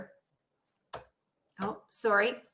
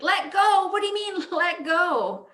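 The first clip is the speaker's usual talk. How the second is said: high-pitched, aghast echo of an order; acted incredulous outcry; 9 semitones higher, 3 dB louder than usual. Man on the cliff answering God, incredulous and high. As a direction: throw it back in mock disbelief, pitch way up. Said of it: "And Jack's like".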